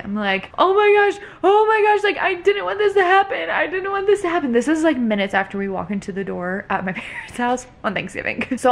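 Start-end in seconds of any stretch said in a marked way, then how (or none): 0.6-4.5 high, louder wail, imitating her own sobbing; replaying her own meltdown in a much higher voice; breaking down crying. Mother retelling her meltdown over the chipped tooth, as a wailing cry. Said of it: "I start breaking down and crying".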